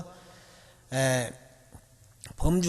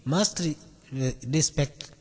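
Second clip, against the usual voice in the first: slow demo; much slower than usual. Shown as a demonstration. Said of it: "의미를 기억을 한 거예요".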